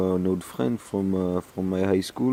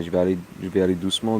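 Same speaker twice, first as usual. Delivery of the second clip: fast rushed speech; much faster than usual. Real, not acted. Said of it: "I speak like this for, uh, the whole night".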